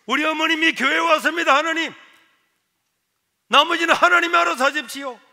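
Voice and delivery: high, loud proclamation to God; acted shouted prayer; crying out in prayer. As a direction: call out the prayer at full voice. Son cries out to God.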